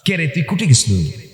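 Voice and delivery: light Bogura dialect voice; a hint of Bogura village speech. Preacher showing the crowd the fun of Bogura dialect. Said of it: "বগুড়ার ভাষার মধ্যে একটা মজা আছে".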